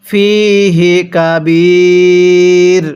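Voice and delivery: ফিহি কাবীর drawn out slowly, pausing on কাবীর; slow recitation stop. Shown as a demonstration. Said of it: "এরকমভাবে পড়ব". (ধীরে ওয়াকফ করে ফিহি কাবীর)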